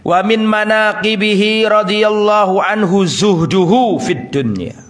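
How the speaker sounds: slow, drawn-out chanted Arabic reading; Arabic recitation. Acted. Preacher reciting Arabic text to his listeners, slow chant.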